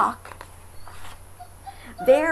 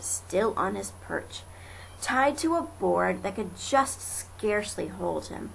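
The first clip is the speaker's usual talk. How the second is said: ordinary delivery, nothing marked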